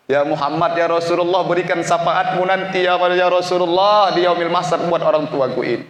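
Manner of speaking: retold plea with swelling force; impassioned plea. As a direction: call out in prayer for your father. Retold funeral plea to the Prophet.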